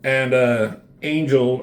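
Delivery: ordinary delivery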